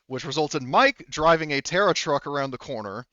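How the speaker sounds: usual speaking voice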